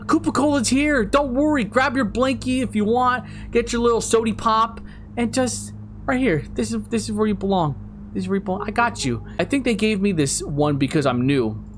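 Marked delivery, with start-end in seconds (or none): 0.1-9.2 put-on high, playful soothing voice as if calming a child; mock comforting. Reassuring the jumpy cameraman, in a high teasing coo. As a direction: coo reassurance at a scaredy-cat, pitched up.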